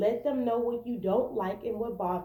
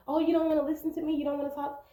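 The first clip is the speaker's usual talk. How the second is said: higher, louder, fast indignant retort; mock indignant; 7 semitones higher, 3 dB louder, much faster than usual. Partner snapping back mid-argument, fast mock indignation. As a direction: snap it back like a hurt partner. Mimicking couple arguing.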